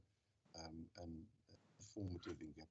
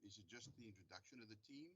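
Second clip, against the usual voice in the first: faint, fast question far from the mic; soft distant voice, hard to hear; barely audible; 13 dB quieter, faster than usual. Real, not acted. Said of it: "your voice is very faint".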